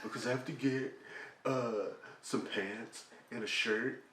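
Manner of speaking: lightly imitated teen boy, halting with uhs; a hint of the kid voice; deep-voiced teenage son. Son asking mom for a mall ride, mock teen-boy voice. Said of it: "She did an impersonation of her son".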